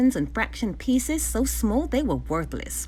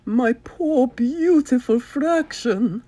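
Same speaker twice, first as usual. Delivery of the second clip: louder, slow lament over the fraction; wailing; 4 semitones higher, 6 dB louder, slower than usual. Acted. Baron mourns his disguised fraction, mock wailing. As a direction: mourn it with a drawn-out wail. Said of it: "wailed Baron von Mathématique".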